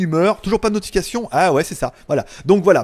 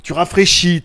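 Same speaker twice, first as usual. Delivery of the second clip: ordinary talk, nothing marked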